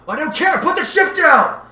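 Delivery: high, very loud desperate yell; shout, half furious and half begging; frantic roar, drawn out at the end; angry, pleading shout. A narrator's reading. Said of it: "shouted, half angry, half pleading".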